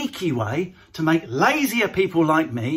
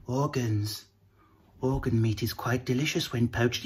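creepy aside; 8 semitones lower, 9 dB quieter than usual. Acted. Patel impression, creepy aside.

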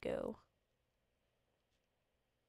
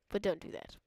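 ordinary talk, nothing marked

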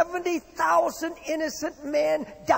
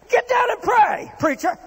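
high, loud barked order to pray; commanding shout; 10 semitones higher, 4 dB louder than usual. Retold or imitated. Captain yelling at the sleeping preacher, high loud shout. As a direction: yell it at him like a ship captain.

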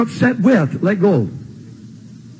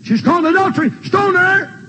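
voicing an accusing crowd, higher and pitched up hard; a mob demanding punishment, raised pitch; 8 semitones higher than usual. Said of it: "They said".

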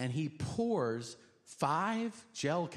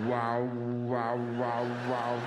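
louder, drawn-out stoned wows; zonked-out wows; dazed wow; 2 semitones lower, 4 dB louder, much slower than usual. Retold or imitated. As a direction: moan it like you're vibrating. High on pills, dazed.

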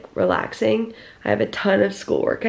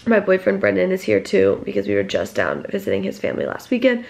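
ordinary delivery

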